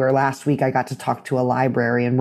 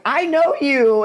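high, slow, lit-up I know you; starstruck, stretched I know you; awed recognition; 12 semitones higher, slower than usual. Retold or imitated. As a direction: let the recognition dawn slowly. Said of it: "she had this moment of".

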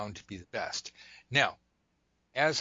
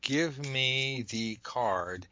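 slow, evenly spaced words; slow, insistent command; demanding inner command; 3 semitones higher, much slower than usual. Shown as a demonstration. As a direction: draw out each word as a deliberate demand. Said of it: "I am literally reaching in going".

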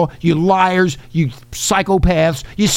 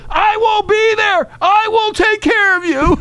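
squeaky mocking caricature; mock high voice. Mocking Biden's voice.

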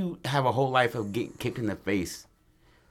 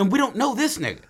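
frustrated shout; 11 semitones higher than usual. Retold or imitated. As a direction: yell it like you're fed up and lost. Friend voiced, frustrated while lost.

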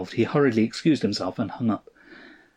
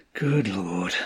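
low-key despairing groan; subdued groan; 3 semitones lower, 3 dB quieter than usual. A narrator's reading. Husband pounds table softly, groaning in quiet despair. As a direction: groan it under control, sinking down. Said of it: "he groaned".

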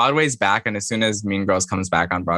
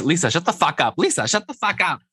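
imitating a guest, pitched up high; hammed-up telling off, raised high; mock higher voice; 8 semitones higher than usual. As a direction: friend impersonates a guest scolding Lisa. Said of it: "That's telling Lisa".

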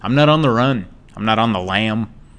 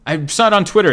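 normal talking voice

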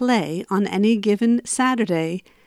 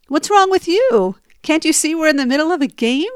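higher, louder, fast snapping back; knee-jerk retort; 6 semitones higher, 4 dB louder than usual. Retold or imitated. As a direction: fire it straight back at him. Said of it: "my knee-jerk reaction was".

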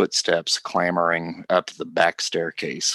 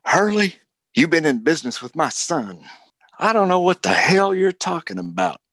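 furious shouting; 10 semitones higher, faster than usual. Acted. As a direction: rage at Hurley, spitting accusations. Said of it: "Cornelia says".

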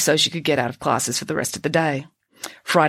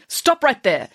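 snapped order; 7 semitones higher, slower than usual. Acted. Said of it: "snapped an angry-looking woman".

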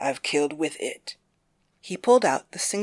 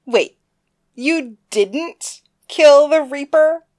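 high, slow, incredulous; a kid's shocked question; surprised little sister; 10 semitones higher, 9 dB louder, slower than usual. Acted. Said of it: "asked Candace".

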